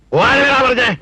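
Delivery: high, forceful yell; shouted order. Acted. Father ordering his son off home, shouting. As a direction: shout it to send him running.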